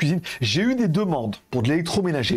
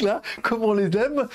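laughing; 7 semitones higher, slower than usual. Real, not acted. Laughing at his own joke.

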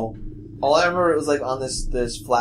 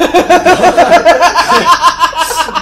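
loud high burst of laughter; real high cackling laugh; big laugh; 13 dB louder than usual. Real, not acted. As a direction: crack up at your own story. Laughing at his own retelling.

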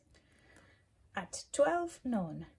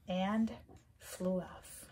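ordinary delivery

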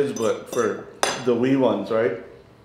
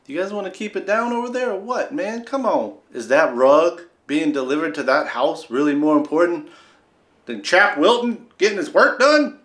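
mock yell at neighbors; 7 semitones higher, 4 dB louder than usual. Yelling at noisy neighbors.

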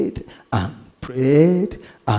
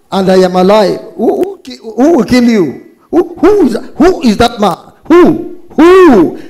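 high, loud, stammering demands; outraged king; 8 semitones higher, 7 dB louder than usual. Acted. King demands Esther name who would kill her, acted outrage.

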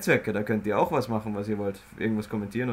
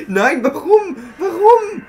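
laughing protest; 10 dB louder, much slower than usual. Real, not acted.

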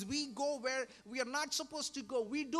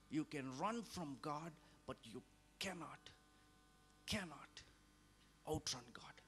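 hushed whispered refrain; 7 semitones lower, 6 dB quieter than usual. Real, not acted. Preacher repeating the warning to the flock, fading to a whisper.

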